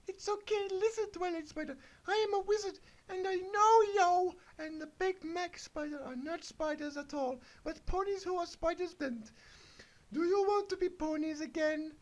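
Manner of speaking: put-on very high, piping girly voice; squeaky Miriam the wizard. Reading the kid's story, Miriam tells the spiders in a very high squeak. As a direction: squeak it like a little pony wizard.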